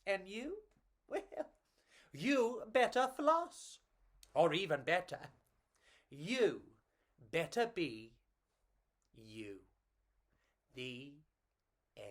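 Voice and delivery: gentle storybook reading; story narration. A narrator's reading. Narrator finishing a bedtime tale for children.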